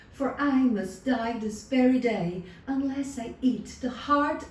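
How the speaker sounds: high, pleading fake distress; pleading lady. A narrator's reading. False lady begs the shepherd to kill the lamb, pleading. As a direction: beg him with put-on despair.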